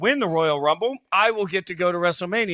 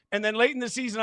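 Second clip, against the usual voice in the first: ordinary talk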